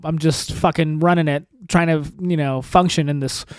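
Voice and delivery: normal talking voice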